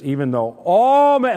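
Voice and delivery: "all" swelling loud and high; emphatic. Retold or imitated. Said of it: "I want to emphasize".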